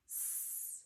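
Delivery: a voiceless hissed "s" with no vowel after it; just the hiss of "s", like air from a tire; hissed s sound. Shown as a demonstration. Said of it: "I hear that suffix".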